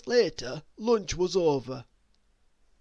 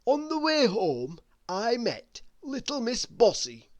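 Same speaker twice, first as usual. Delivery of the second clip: plain reading voice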